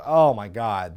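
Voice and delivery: ordinary talk, nothing marked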